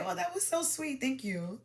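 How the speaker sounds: soft, understated thank-you, high then dropping; sweet thanks. Shown as a demonstration. Coach models thanking the man for a drink, softly and sweetly. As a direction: say a light, gracious thanks without gushing.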